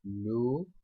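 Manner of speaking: "Lucy" split in two with a long gap; slow sounding-out. Shown as a demonstration. Teacher writing the example for students, slow sounding-out. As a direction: say "लू", wait, then "सी".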